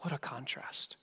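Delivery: breathy hushed aside before the contrast. Real, not acted. Preacher to congregation, a hushed aside. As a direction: drop to a near whisper.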